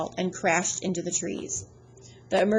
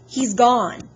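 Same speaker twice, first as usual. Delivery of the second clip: louder, raised urgent call; yelling; 4 semitones higher, 6 dB louder than usual. Acted. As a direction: call out the bad news. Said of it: "Annabeth yelled".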